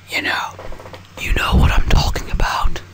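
whispered aside. Real, not acted. Confiding to viewers in a whisper. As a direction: lean in and whisper it.

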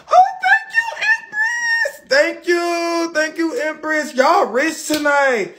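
very high squealed thanks, settling to a high gush; excited squeal. Real, not acted. Thanking Empress for a gift, high excited squeal. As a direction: squeal with delight, drawing out the words.

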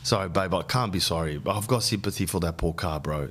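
ordinary talk

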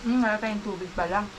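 talking while chewing food; muffled words between bites; mouth full. Real, not acted. Seen 0: Both eat throughout the clip; speaker unclear, likely woman mid-chew.

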